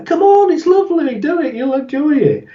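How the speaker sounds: very high sing-song cajoling; hammed-up, high-pitched cheery persuasion; coaxing. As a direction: talk a reluctant friend into it. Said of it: "you have to be talked into it".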